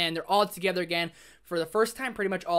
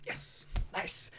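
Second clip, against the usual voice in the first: a secret, pleased whisper; hushed gleeful whisper; whispered yes; 10 dB quieter than usual. Retold or imitated. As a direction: whisper it like a private little win. Quietly glad at a death.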